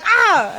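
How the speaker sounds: short high yelp of shock; retold shriek at the gin bottle; startled scream. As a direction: jump like you saw a snake. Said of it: "I literally screamed".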